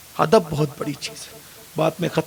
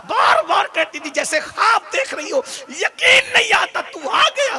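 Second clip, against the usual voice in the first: preaching at full force; 11 semitones higher than usual. Acted. Preacher tells the congregation of the mother's reunion, impassioned high shouting.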